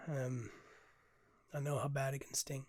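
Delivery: ordinary talk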